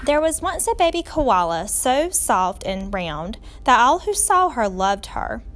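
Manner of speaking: ordinary delivery, nothing marked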